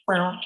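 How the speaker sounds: short plucked "Bow" green frog imitation; banjo twang frog call. Shown as a demonstration. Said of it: "sounds like a banjo string twang".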